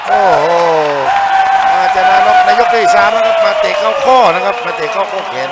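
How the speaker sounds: loud, high thrilled knockout call; excited shout. Real, not acted. Knockout kick, commentator erupts.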